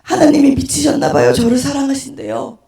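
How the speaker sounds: mock tearful outcry; imitated sobbing. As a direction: bawl it out, dragging the ending. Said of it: "엉엉 울고 있잖아요".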